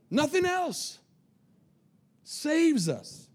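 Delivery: "Nothing else" thrown up high, then "saves us" falls; high emphatic exclamation. Real, not acted. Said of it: "Say exclamation point".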